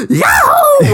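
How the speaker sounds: hammed-up joyful cry; gleeful yahoo. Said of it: "she's like".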